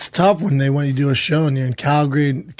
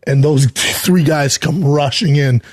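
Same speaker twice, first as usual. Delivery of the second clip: ordinary talk, nothing marked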